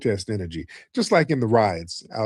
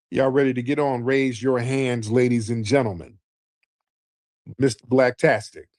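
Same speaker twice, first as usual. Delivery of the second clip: ordinary talk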